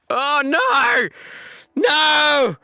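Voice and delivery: high, loud anguished wail; anguished cry. Real, not acted. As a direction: wail out in mock despair. Hiccups attack, anguished.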